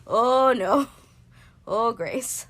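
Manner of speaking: loud, high mock panic; mock alarm. Fearing inherited bipolar, a loud mock cry. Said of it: "and I'm like".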